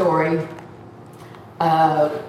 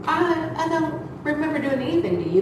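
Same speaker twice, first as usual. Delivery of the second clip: high, quick defensive reply; retold high-voiced bafflement; mock bewildered protest; 9 semitones higher, 3 dB quieter, faster than usual. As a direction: protest innocence in a pitched-up voice. Answering the screaming man, retold.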